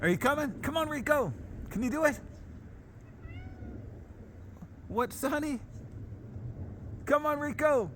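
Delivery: high, soft coaxing voice; talking to a dog. Real, not acted. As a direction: coax the dog along in a high voice. Coaxing dog across snow.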